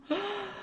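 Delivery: soft gasp of alarm; high sharp gasp. Acted. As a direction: gasp as if seeing a broken lamp. Reader reacts to broken lamp.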